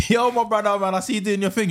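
high, loud hype-man greeting; big him up with energy; hyped greeting. Retold or imitated. Hyping him up, retold greeting.

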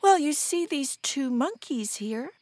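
high, plaintive aunt voice; feigned sorrow. Acted. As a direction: lead into bad news pitifully.

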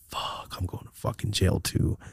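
hushed panicked whisper. Retold or imitated. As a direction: whisper it aside to Rosie. Whisper to Rosie.

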